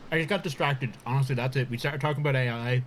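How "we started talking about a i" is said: normal talking voice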